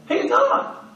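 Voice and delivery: ordinary talk, nothing marked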